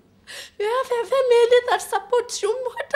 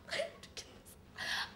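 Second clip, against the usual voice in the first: hammed-up breathless whisper; mock whisper; 20 dB quieter than usual. She whispers her mock despair to herself. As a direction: whisper the line like a dramatic secret.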